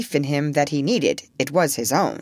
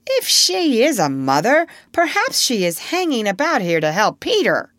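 higher, louder wary pirate; suspicious; 5 semitones higher, 4 dB louder than usual. A narrator's reading. Suspicious Starkey warns that she may help Peter, suspiciously. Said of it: "the more suspicious Starkey said".

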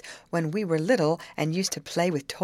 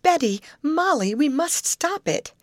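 distressed cry; 10 semitones higher, 4 dB louder than usual. A narrator's reading. Grace to her friends as the boat drifts off, distressed cry. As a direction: cry out in mild alarm, pitched well up. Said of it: "cried Grace".

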